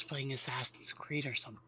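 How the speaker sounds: hushed, secretive voice; whispering. Real, not acted. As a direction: keep it quiet, half-whispered. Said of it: "'Cause you're whispering?".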